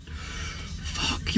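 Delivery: voiceless, very soft swearing; spent whispered curse. Real, not acted. Vlogger drained after ranting at a viewer, whispered curse. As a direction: let the curse out under the breath.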